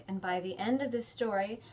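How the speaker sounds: ordinary delivery, nothing marked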